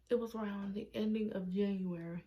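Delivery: normal talking voice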